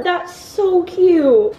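delighted. Real, not acted. Opening gifts.

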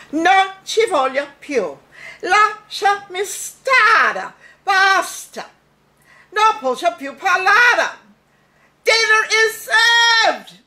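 high, loud, dramatic melodrama diva; Bette Davis in Italian. Retold or imitated. Kid at the sink doing Bette Davis, melodramatic and loud. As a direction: overplay a tragic film heroine, building to a cry. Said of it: "an impression of Bette Davis in an Italian movie".

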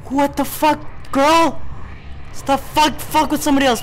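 high, loud, spooked complaint; rattled protest. Real, not acted. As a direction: tell the ghost off loudly. Scolding the ghost after scare.

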